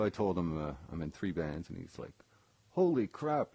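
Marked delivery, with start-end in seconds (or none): 2.7-3.5 higher, louder mock amazement; mock amazed. Eddie reacting to the guy in three bands, mock amazed. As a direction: play it up like he's impressed.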